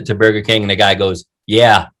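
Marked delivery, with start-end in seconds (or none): 1.5-1.9 louder, blunt mock greeting; curt worker. Burger King worker answering a late-night customer, curt. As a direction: imitate a worker who can't be bothered.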